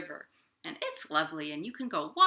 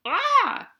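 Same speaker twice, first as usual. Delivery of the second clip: surprised cry; 10 dB louder than usual. A narrator's reading. Characters crash into Duck.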